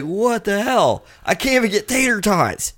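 high-pitched, indignant retold outburst; exasperated disbelief. Shopper finds all the shelf is gone, high exasperated disbelief.

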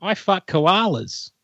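mock Australian; Australian accent. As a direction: drawl it like an Aussie bloke. Australian impression.

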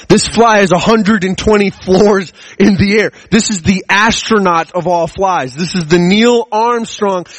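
slow, high, hushed wonder at a fly; mock-reverent, slow and high, hyping up a fly; mock awe. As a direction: build the fly up like a hero. Awed build-up about the fly.

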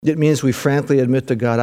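ordinary talk

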